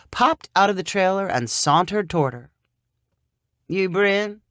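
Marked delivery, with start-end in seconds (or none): 3.7-4.4 a hint of a Southern drawl; lightly voiced country man. A narrator's reading. Sonny greeting Bryn in his yard, laconic.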